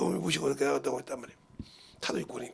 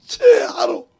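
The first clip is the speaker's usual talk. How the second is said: high, loud, slow laugh breaking into a cry; 11 dB louder, much slower than usual. Real, not acted. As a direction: let the laugh crack into tears. Emotional outburst at the news. (울음 섞인 웃음)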